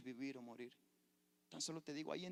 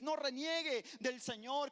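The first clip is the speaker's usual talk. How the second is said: "No reniegue del Señor" cried out higher and louder, slow, with "No" ringing; full-force preaching cry; 12 semitones higher, 11 dB louder, slower than usual. Acted. Pastor crying out to the church, climactic shouted preaching.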